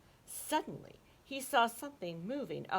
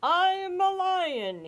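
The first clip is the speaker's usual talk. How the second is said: very slow, much higher and louder, proud; proudly; 11 semitones higher, 10 dB louder, much slower than usual. Acted. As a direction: puff up and announce it. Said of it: "he said proudly".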